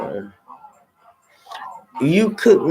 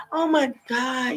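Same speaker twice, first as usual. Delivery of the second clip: slow, soft, exasperated oh my God; mock exasperation; 8 semitones higher, 6 dB quieter, slower than usual. Answering a friend who wants to cook for her, mock exasperated. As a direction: drag it out with a sigh of mock disbelief.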